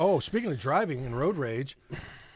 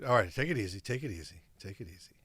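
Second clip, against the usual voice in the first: low, calming, fading softer; soothing; 6 semitones lower, 6 dB quieter, faster than usual. Real, not acted. Host calming a worked-up co-host, low soothing murmur. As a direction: talk him down, quieter with each repeat.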